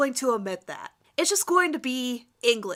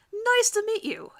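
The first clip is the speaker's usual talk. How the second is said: innocent; 10 semitones higher than usual. Acted. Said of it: "looking innocent".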